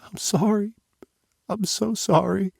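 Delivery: high, pleading sorry through tears; tearful apology. Acted. Daughter sobbing her apology to her father, high and tearful. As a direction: beg forgiveness, voice high and tight. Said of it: "she kept apologizing".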